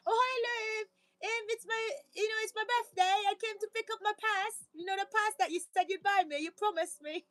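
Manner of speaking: high-pitched imitation of a woman with a European accent. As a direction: plead as the ex, in a mock European accent. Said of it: "an accent. So they were from, you know, Europe".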